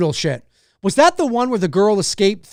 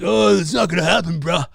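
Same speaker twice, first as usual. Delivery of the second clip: lightly voiced stoner, lower; 6 semitones lower, faster than usual. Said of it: "Stop stereotyping me with your stoner voice".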